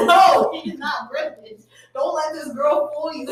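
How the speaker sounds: high, stretched-out retold objection; mock exasperated protest. Friend retelling how she warned him, mock exasperated. Acting out her own protest.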